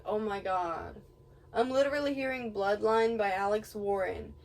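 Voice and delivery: usual speaking voice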